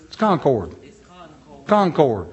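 Concord said CON-cord; full OR vowel in Concord's second syllable; corrected pronunciation. Shown as a demonstration. Correcting the town's name, Concord as CON-cord. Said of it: "It's not Concord".

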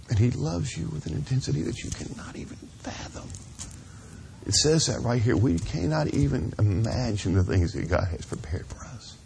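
ordinary talk

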